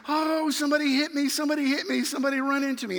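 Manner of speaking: high-pitched imitation of a frantic victim; mock screaming. Bleeding stranger yells at the driver, mock screaming. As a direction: cry out high, imitating someone pleading.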